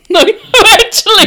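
high, loud words through laughter; laughing interruption. Real, not acted. Woman correcting partner's story, laughing hard. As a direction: burst in laughing, real amusement.

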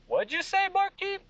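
put-on high-pitched drunken challenge; Craig. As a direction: call him out, voice shooting way up. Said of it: "Craig goes".

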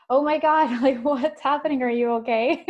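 imitating a worried mom, high and loud; mock-alarmed outburst with a laugh breaking in. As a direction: blurt it out, retelling mom's startled concern. Mom alarmed at crying daughter.

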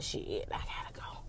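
real whispered sign-off, no voice at all. Real, not acted. Signing off to viewers in a hurried whisper. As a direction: breathe it out as you rush off.

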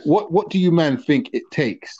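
ordinary delivery

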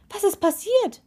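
high, urgent question; calling out. A narrator's reading. Friend running up to the lakeshore, calling urgently. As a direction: call it out high, alarmed. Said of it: "rief er".

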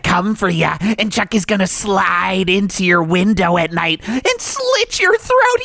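high, shrill-free squeaky cheerful pitchman going menacing; Chucky voice. Acted. Radio host doing Chucky for a frame shop ad. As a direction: play a creepy mascot chirping his sales pitch. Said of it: "let me just do this as Chucky".

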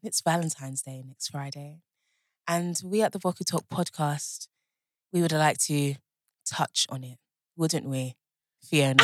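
sexy voice. Pretend. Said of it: "we need to redo it".

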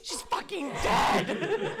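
high, slow hammed-up scream; mock panic yell. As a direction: wail it out in fake panic. Mock panic yell after fall.

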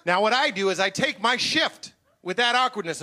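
usual speaking voice

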